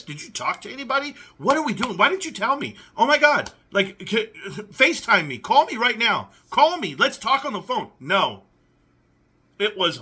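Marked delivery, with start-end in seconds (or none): 0.0-7.9 frantic roommate. Pretend. Voicing a frantic roommate's replies.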